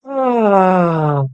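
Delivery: loud open released sigh. Shown as a demonstration. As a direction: let the breath fall out with sound. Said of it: "Breathe in".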